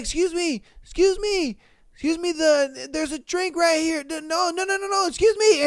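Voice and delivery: nervous, apologetic little voice; polite guy. Acted. Acting out the helpful guy.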